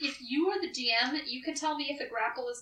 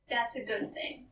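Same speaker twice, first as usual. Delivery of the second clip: ordinary talk, nothing marked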